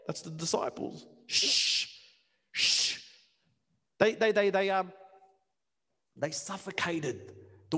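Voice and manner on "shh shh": unvoiced hushing shh; shushing. Disciples hushing blind Bartimaeus, unvoiced shh. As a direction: hush him like a disciple silencing a beggar.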